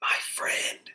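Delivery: soft, unvoiced whisper; hushed bedtime whisper; sleepy whisper. A narrator's reading. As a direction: whisper drowsily to a friend. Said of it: "whispered a sleepy little fox".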